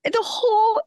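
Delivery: mock-tearful high wail; upset daughter. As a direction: act out a mortified preteen's lament. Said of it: "really upset".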